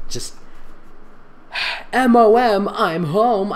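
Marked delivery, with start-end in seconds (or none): 1.9-3.5 high, loud spelled-out yell; mock screech. Teen calling out to mom on arriving home, loud and high. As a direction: yell it through the empty house. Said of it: "I screeched".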